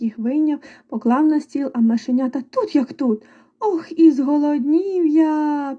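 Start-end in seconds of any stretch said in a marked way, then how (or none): 3.6-5.8 slow, drawn-out mouse whine; squeaking mouse. A narrator's reading. First mouse hungry for pies, drawn-out squeak. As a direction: stretch it out like a hungry little mouse. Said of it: "пищить круть".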